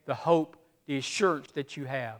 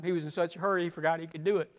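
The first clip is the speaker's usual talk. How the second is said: ordinary talk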